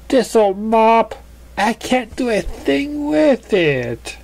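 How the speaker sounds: high, slow lament over a messy mane; fussy pony voice. Acted. Pony fretting to friends, high and slow. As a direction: sigh over hopeless hair.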